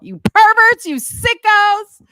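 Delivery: high, loud teasing scold, slowing; playful yelling. Real, not acted. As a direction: yell it at them jokingly. Teasing scolding after fake flash.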